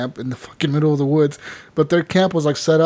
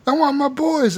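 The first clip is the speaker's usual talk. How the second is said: high, pleading mock whine; whiny captured outlaw; 11 semitones higher than usual. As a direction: whine like a bandit who lost his gang.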